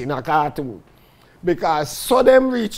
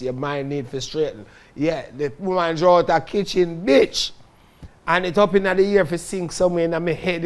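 ordinary talk, nothing marked

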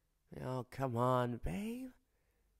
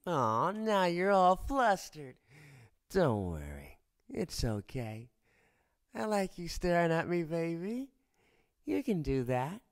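usual speaking voice

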